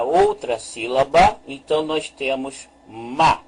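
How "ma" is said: "ma" read as one open syllable ending in A. Shown as a demonstration. Teacher reads the other syllable for learners, "ma" set apart clearly. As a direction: read out "ma" as a single, separate syllable. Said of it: "se nós vamos ler".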